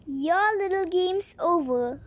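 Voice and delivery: usual speaking voice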